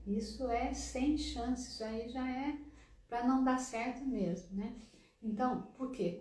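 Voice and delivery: ordinary delivery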